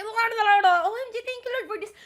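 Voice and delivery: squealing mock thanksgiving; mock grateful prayer. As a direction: ham up a giddy prayer.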